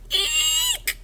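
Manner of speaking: tiny high-pitched eek; startled squeak. Acted. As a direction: squeak it out in fright. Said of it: "I squeaked".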